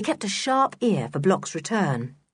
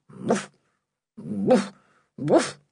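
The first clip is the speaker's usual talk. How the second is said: imitated dog bark; much slower than usual. Timmy barking from the secret passage, muffled. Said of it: "his doggy voice could be heard".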